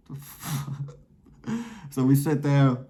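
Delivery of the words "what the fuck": soft bewildered aside, much softer; inner disbelief. Retold or imitated. Teen staring at his mom, softly baffled aside. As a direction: think it to yourself, stunned. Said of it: "I'm looking at my mind like".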